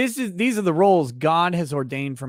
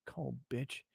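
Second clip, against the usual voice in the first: muttered insult; 3 semitones lower, 18 dB quieter than usual. Retold or imitated. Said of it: "you're like".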